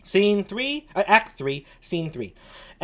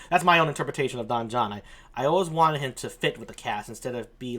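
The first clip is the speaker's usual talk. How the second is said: ordinary talk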